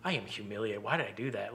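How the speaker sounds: soft, low retold self-reproach; mortified. Retelling his thoughts after yelling in a theater, quietly mortified. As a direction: sink into embarrassed muttering. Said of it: "went to intense embarrassment".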